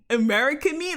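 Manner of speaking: repeating it in disbelief; high, loud disbelief; incredulous. Real, not acted. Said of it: "That's not even a word".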